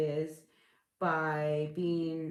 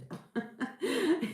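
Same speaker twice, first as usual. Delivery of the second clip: high laugh; 11 semitones higher than usual. Real, not acted. Laughs at splurging.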